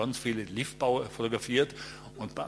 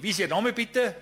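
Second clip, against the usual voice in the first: loud, higher retort; firing back; 9 semitones higher, 6 dB louder than usual. Retold or imitated. Photographer fires back at the yelling man, loud. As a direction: snap back hard and loud. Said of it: "ich schieß sofort zurück".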